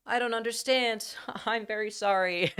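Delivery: high-pitched meek assistant voice, laughing through it; mock-pitiful robot helper; apologetic Japanese Alexa. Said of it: "And she'd be like".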